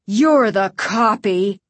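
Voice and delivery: loud, slow accusation; slow, emphatic counter-accusation; accusing. A narrator's reading. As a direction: accuse him slowly and forcefully. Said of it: "the other Doctor Brewer accused".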